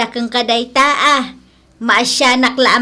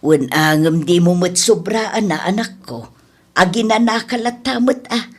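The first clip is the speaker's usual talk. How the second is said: lower, lightly voiced elderly mother; old mother; 12 semitones lower than usual. Mother gently warns her son Gilbert, lower voice. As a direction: speak as a worried old nanang, lower.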